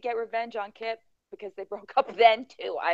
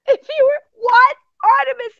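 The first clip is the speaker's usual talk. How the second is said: shocked outburst; 12 semitones higher, 13 dB louder than usual. Real, not acted.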